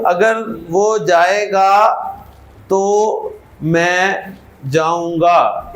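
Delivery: sentence read slowly for translating; slow dictation. Shown as a demonstration. Teacher reading the sentence to translate, slowly. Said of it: "इसकी अंग्रेजी कौन कौन कर सकता है".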